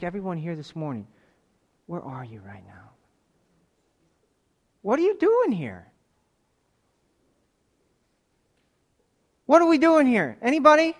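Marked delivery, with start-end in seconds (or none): 1.9-2.9 hushed searching question. Acted. Preacher asks the congregation where they are, hushed. 4.8-10.9 high, loud challenge to the room; calling out. Real, not acted. Preacher challenges the congregation in the pews, loud and high.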